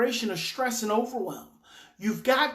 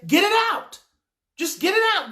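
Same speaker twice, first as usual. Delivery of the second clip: high, loud, emphatic urging; acted parent matching a child's intensity; urgent coaxing shout; 10 semitones higher, 8 dB louder than usual. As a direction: push them loudly to let it out. Coaching parent to meet child's outburst.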